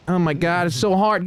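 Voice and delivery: ordinary talk, nothing marked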